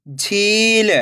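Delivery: झील joined as one word with long ई; blended word. Shown as a demonstration. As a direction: read झील whole, stretching the झी. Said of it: "खींच के पढ़ना है".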